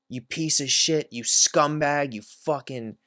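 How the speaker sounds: an angry stranger on the phone rips into the speaker, retold furious; retold angry caller. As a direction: spit out each insult like the angry caller. Angry caller shouting insults.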